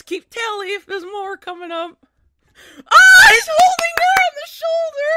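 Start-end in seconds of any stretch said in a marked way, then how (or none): 2.9-5.1 high, loud delighted shriek; fangirl squeal. Real, not acted. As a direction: squeal with pure joy at the screen. Fan squealing at anime scene.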